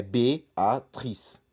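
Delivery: "Beatriz" drawn out in separate syllables with closed E; French "Beatriz", each syllable apart. Shown as a demonstration. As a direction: break "Beatriz" slowly into bé-a-trice. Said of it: "tem E com acento".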